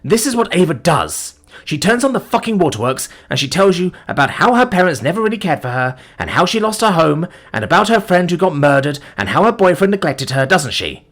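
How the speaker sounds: high, loud, contemptuous rant; angry rant. Acted. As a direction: pace and rage. Sean's angry stride.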